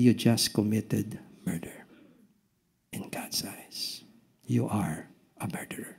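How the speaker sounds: a grave whispered judgment; hushed whisper. Acted. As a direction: whisper the verdict, low and unvoiced. Preacher voices Jesus's verdict.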